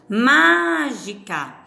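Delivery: "Mágica" slow, with a long MÁ; stress on MÁ. Shown as a demonstration. Teacher showing the antepenultimate syllable, "Mágica" with MÁ held long. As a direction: stretch the first of the three syllables in "Mágica". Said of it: "a antepenúltima das três sílabas".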